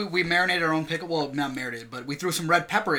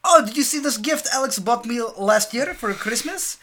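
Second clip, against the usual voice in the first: high, slow show-off mom voice; imitated mother; 7 semitones higher, 4 dB louder, slower than usual. Imitating mom talking to her dinner guest, high mom voice. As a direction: play the mom coyly bringing up a gift. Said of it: "the way she did it was so funny".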